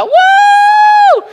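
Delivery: very high, loud held "woo"; piercing shout of release; uncontained whoop. Retold or imitated. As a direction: burst out with a whoop you can't contain. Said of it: "no gritábamos, yo no me puedo contener".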